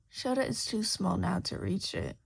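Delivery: ordinary delivery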